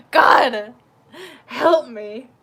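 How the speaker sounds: loud, despairing half-laughed plea; loud comic despair; exasperated plea. Real, not acted. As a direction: cry out to heaven, fed up. Exasperated plea after outburst.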